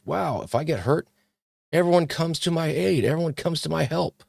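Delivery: normal talking voice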